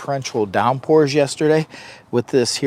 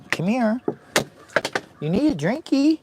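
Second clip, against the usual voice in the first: much higher sing-song pet voice; 11 semitones higher than usual. Real, not acted. Baby talk to thirsty dog.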